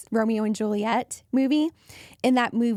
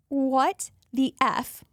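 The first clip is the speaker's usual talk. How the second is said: retold shock; 3 semitones higher, much slower than usual. Retold shock at surprise wedding.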